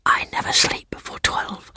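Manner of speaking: hushed night whisper. A narrator's reading. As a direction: keep it to a breath.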